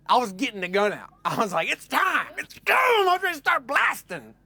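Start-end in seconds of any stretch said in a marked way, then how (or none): 1.7-4.3 high, loud gung-ho shout; over-excited. Retold or imitated. Hillbilly with the handgun ready to fire, over-excited high shout. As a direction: whoop it up, voice leaping high. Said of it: "I'm getting a little excited".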